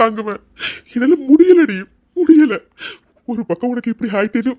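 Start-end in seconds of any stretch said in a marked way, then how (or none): 0.9-2.6 crying out, high and loud; husband breaking into loud tears. Acted. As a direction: sob it out, at the end of his rope. Husband breaks down over her pain.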